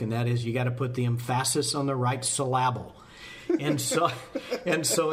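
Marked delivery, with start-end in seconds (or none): 0.7-2.9 "emphasis" and "syllable" stressed on their second syllables; joke misplaced stress. Shown as a demonstration. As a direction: say em-FASS-is and sil-LAB-ul for emphasis and syllable. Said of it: "let me throw in my usual joke".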